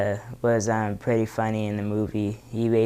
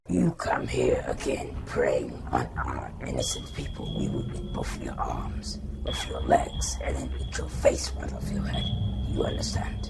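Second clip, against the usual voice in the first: low menacing monster whisper; low whispered monster threat; Venom impression; 8 semitones lower, 4 dB quieter than usual. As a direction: threaten from the dark. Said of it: "he said".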